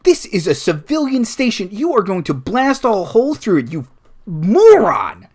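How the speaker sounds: furious, high-pitched tirade building to a yell; loud exasperated rant ending in a growled ugh; screaming into comm. Acted. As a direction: bellow at a reckless idiot over the radio. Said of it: "he starts screaming into the comm".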